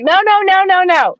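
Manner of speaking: put-on high childish refusal; stubborn kid refusing. Toddler refusing the cup to her dad, high whiny no. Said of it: "She's like".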